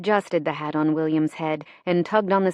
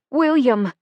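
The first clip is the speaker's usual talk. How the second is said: high, loud call of a name; alarmed cry; 7 semitones higher, 6 dB louder than usual. A narrator's reading. Mother searching for her baby after a blast, alarmed.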